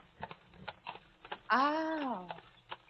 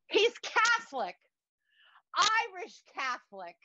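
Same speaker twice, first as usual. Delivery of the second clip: excited gushing; 6 semitones higher than usual. Retold or imitated. Thrilled about her blind date.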